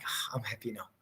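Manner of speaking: soft whispered contentment. Retold or imitated. Preacher voicing Jesus, content at last, in a whisper. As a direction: breathe it out, satisfied. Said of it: "And he's like".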